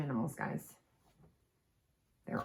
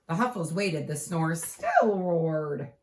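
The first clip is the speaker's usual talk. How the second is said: ordinary delivery, nothing marked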